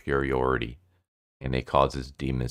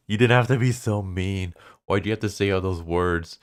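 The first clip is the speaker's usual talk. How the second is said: high, whiny pretend tearfulness; mock crying; 5 semitones higher than usual. Insulted exorcist crying to the ghost, mock tearful whine. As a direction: whimper it like a wounded kid, hammed up. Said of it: "Kiyoshi starts crying".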